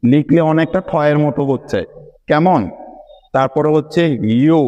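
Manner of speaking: ordinary talk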